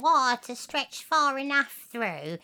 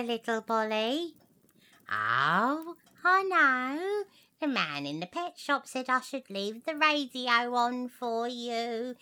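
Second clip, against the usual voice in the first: ordinary talk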